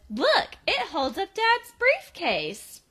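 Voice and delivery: put-on high, drawn-out kid show-off voice; playful mock excitement; gleeful kid. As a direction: imitate a little brother showing off, slow and high. Voicing gleeful kid showing off.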